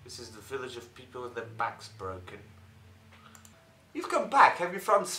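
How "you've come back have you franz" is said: raised, high-pitched greeting; loud high character voice. Acted. Voicing character greeting hero.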